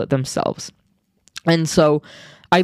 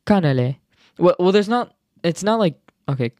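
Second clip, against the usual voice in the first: usual speaking voice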